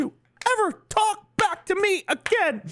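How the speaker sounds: very high falsetto, punched word by word; bullying cartoon mouse threat in a squeaky voice; Mickey from South Park. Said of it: "the Mickey from South Park".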